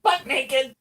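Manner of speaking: put-on high squeaky cursed old man voice. GM plays Daryl in character.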